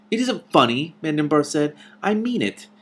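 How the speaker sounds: insist, feeling a little hurt; hurt insistence. A narrator's reading.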